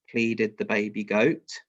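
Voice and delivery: usual speaking voice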